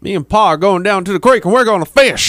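a hammed-up backwoods Texan kid; hillbilly Texas accent. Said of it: "putting on the fucking accent".

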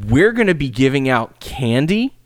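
high, slow, every line lifted like a question; valley girl. Pretend. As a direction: drawl it like a bored valley girl. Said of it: "end everything like you're asking a question".

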